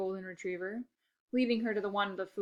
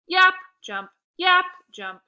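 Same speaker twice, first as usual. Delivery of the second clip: higher, loud bouncy yaps; mimicking yapping; 10 semitones higher, 12 dB louder, slower than usual. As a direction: bounce like the little dog.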